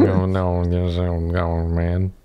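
mumbled, drawn-out cartoon impression; lightly voiced run-together mumble; Boomhauer. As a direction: do a loose mumbling character impression. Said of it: "like, boomhauer noises".

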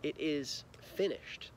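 quiet and slow, almost gentle; soft final words. A narrator's reading. Jesus's last words read softly and slowly to kids. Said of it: "with a loud voice said finally".

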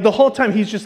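ordinary delivery

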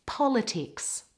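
"Politics" with stress on the first part; first-syllable stress. Shown as a demonstration. Teacher models the last word, first-syllable stress on "Politics". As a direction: hit the opening syllable of "Politics". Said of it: "stress is on the first part".

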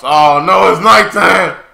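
high, loud cry of dismay; loud alarm. Real, not acted. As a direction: call out the danger in alarm. Alarm as night falls on screen.

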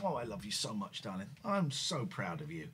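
low, soft, tender pet talk; sweet nothings. Real, not acted. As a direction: murmur love to the cat.

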